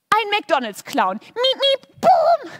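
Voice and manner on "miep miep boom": squeaky high beeps, then a loud high boom; miep miep boom. Acted. Clown character bursting in, high squeaky beeps, loud boom. Clown's sound-effect catchphrase.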